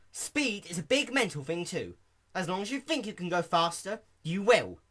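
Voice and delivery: lightly voiced sporty pony; Rainbow Dash voice. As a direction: Dash coaching her friend on confidence. Dash gives advice.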